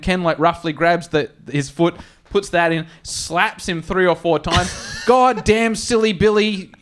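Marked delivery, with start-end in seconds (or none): 5.1-6.7 mock scolding. Ken scolding the driver.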